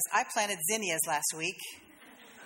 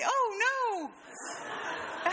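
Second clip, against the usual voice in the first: very high, squeaky mock panic; hammed-up tiny bud in distress; panicking flower bud. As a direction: squeal as if coming apart. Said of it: "Can you say".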